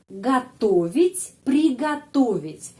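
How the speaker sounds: готовить and приготовить said slowly, o reduced to a; teacher modelling готовить; slow careful Russian. Shown as a demonstration. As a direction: spell out the stress slowly for learners. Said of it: "penekanannya berada pada huruf O".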